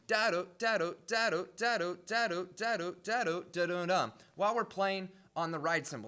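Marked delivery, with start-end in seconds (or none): 0.1-4.1 scatted drum rhythm. Shown as a demonstration. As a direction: sing the snare-bass pattern as da-do syllables. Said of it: "we do play this snare bass thing".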